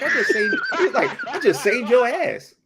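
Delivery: high, incredulous retort through laughter; laughing mock protest. Acting out Cable's retort while laughing.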